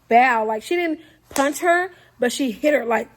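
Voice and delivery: ordinary talk, nothing marked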